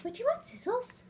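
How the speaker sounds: talking to a dog. Real, not acted. Asking dog.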